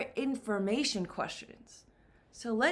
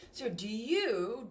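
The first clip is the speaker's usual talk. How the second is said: ordinary delivery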